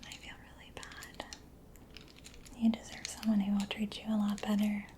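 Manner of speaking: usual speaking voice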